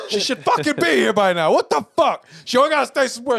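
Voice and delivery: high, loud exasperated venting; angry rant. Retold or imitated. Complaining to a friend about waiting for her, a loud annoyed rant. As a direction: vent it loudly, fed up. Ranting about late girlfriend.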